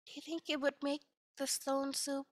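a hint of a shy, hopeful villager; lightly voiced timid asking. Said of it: "another one comes up to the traveler and says".

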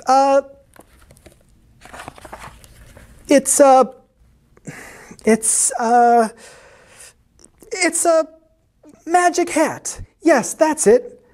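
high, halting squeak, groping for an excuse; squeaky, hesitant cat voice. A narrator's reading. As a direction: squeak and stall, then land on the idea. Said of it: "he squeaked".

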